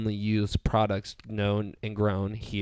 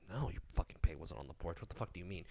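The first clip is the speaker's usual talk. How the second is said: whispered retort; 4 semitones lower, 13 dB quieter, faster than usual. Retold or imitated. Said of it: "And I was like".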